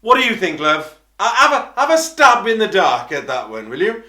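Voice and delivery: high, loud, mocking comeback; sarcastic retort. Answering the stage-door woman, loud and sarcastic. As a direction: scoff at her as if it's obvious.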